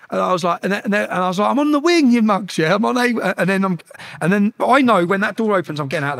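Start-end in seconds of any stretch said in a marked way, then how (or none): 1.5-3.3 high, raised defiant retort; defiant call. Retold or imitated. Prisoner answering jeering inmates through the window, defiant call. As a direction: call it back cockily.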